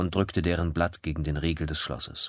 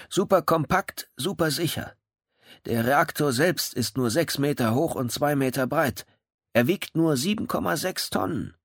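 proud Kemba; 9 semitones higher than usual. Acted. Kemba boasts to the police about his reactor, proud and higher. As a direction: show off the machine with pride.

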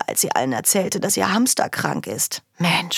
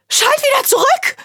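shrill high yell, much louder; shouting; 5 dB louder than usual. Acted. Girl yelling at her brother over the remote, much higher and louder. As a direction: scream at him to switch it back. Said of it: "schrie ich".

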